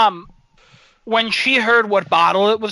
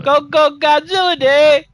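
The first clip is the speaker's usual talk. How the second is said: louder, higher rally cry; mock cheer; 6 semitones higher, 5 dB louder than usual. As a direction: chant it like a fan. Cheering the Godzilla Day.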